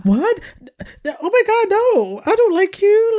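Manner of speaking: high, loud mock squeal of disgust; mocking Amber. Two friends mimic Amber rejecting Barnett, high and loud. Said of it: "She's like".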